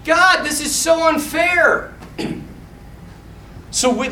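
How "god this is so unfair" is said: high, slow comic complaint; hammed-up self-pity; mock whining. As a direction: whine to heaven like a wronged man. Imagined complaint by Joseph.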